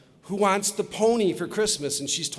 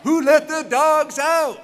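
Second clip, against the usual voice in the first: high, drawn-out crowd chant shout; loud and proud; 11 semitones higher, 9 dB louder, slower than usual. Real, not acted. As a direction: belt it out to rally everyone. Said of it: "I wanna hear it loud and proud".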